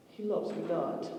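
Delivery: soft, slow letdown; a hint of a pout; disappointed girl. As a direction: let it sink, quiet and sad. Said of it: "Then she goes".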